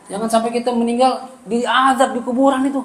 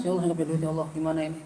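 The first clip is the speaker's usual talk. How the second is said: soft, lower, pleading plea to God; tearful prayer; 4 semitones lower, 12 dB quieter, much faster than usual. Retold or imitated. Preacher praying to God with no money left, softly pleading. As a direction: murmur it like a man close to tears. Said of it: "saya ngadu, saya nangis".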